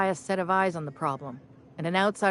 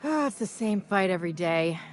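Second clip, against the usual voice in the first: ordinary delivery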